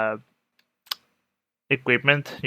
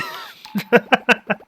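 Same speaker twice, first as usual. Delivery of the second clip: high laugh; 11 semitones higher, 6 dB louder than usual. Real, not acted. Laugh after dry retort.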